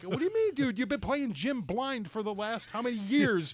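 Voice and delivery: put-on high, exasperated rant at a teenage son; retold yelling. Dad retells berating his son over glasses, yelling. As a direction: yell it in disbelief, pitch jumping way up. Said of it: "I'm yelling at him".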